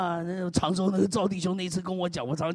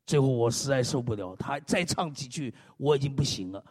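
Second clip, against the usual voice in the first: ordinary delivery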